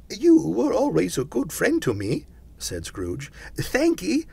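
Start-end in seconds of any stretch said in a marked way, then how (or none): 0.1-2.3 high, loud, eager voice; grateful Scrooge. A narrator's reading. Scrooge thanking his old friend, grateful. 3.6-4.3 very high little thanks; grateful Scrooge. A narrator's reading. Scrooge thanking Jacob's ghost, grateful.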